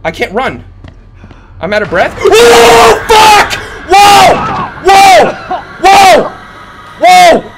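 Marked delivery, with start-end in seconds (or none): 2.2-7.5 very high, loud yells; panicked screaming. Real, not acted. Gamer out of breath and chased in game, panicked screaming.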